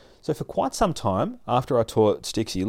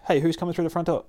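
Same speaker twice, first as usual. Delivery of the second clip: voiced dog; 8 semitones higher, faster than usual. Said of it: "her dog's just sitting on the couch going".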